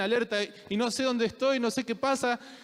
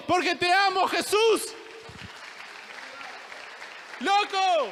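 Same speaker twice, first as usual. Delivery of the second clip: preaching at full force; 4 semitones higher than usual. Acted. Pastor stirs the congregation toward faith, building fervent preaching. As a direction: climb to a passionate crescendo.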